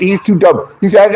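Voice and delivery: ordinary talk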